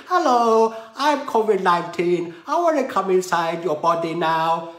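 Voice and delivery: a hint of a cheesy creepy visitor; virus character voice. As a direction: play the virus as a chirpy stranger.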